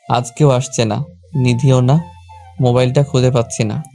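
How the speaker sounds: plain reading voice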